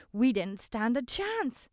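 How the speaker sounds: a shaken youth, slowly; a scared young man's reply; younger voice. A narrator's reading. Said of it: "a younger voice replied".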